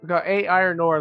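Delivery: ordinary talk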